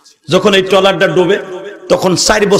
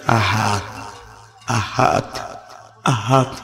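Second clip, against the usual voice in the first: much softer and lower, barely there; faint weak murmur; 8 semitones lower, 10 dB quieter, slower than usual. Acted. Beaten Bilal murmurs his faith, faint and low. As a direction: whisper it as a beaten, dying man. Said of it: "লড়াই লড়াই কয়".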